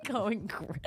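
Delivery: ordinary delivery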